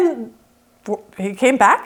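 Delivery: ordinary talk, nothing marked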